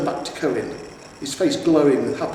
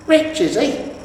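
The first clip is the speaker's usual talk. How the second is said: high, loud, doubtful wife voice; sceptical troll wife; 12 semitones higher, 7 dB louder, slower than usual. A narrator's reading. Troll wife doubting the hero, high and sour. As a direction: size him up sourly.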